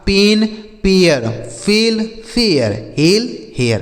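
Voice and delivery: पेन against पेयर, eh then eh-ya, slowly; teacher reading the word pairs slowly; pronunciation drill. Shown as a demonstration. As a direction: slowly contrast फेल and फेयर. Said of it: "जैसे हमने पढ़ा".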